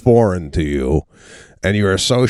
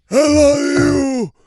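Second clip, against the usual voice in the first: mock deathbed gasp; slower than usual. Son imagining his dying father's last gasp, hammed-up. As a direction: heave it out like your last breath.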